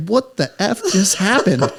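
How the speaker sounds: slow, high, laughing disbelief; stunned disbelief. Retold or imitated. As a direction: let it drop out slowly through a laugh. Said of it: "Bobby's looking at me like".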